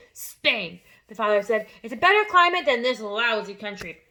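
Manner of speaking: brusque father. A narrator's reading. Father answering Matilda brusquely.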